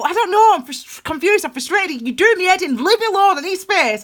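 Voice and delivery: high, loud, fed-up plea to be left alone; frustrated plea. Retold or imitated. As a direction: push people away, exasperated. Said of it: "But instead of saying".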